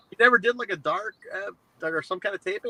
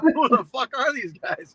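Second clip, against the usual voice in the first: laughing; 9 semitones higher, slower than usual. Retold or imitated. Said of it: "You're like".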